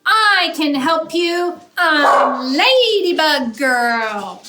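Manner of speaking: put-on high, loud, slow heroic child voice; proud little hero. Lulu introducing herself to the ants, slow proud hero call. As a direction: announce yourself like a tiny superhero. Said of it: "she says".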